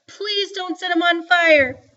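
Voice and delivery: high, loud tearful begging; wet, twisted-up voice. A narrator's reading. Joetta begs Mama not to burn Byron, tearfully. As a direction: plead hysterically through tears. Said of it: "Her voice was all wet and twisted up".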